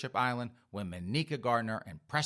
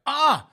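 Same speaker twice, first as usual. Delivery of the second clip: short, loud, high war cry; enraged yell; 14 semitones higher, 11 dB louder than usual. Acted. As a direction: explode in a mock scream of rage. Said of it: "Monica's like".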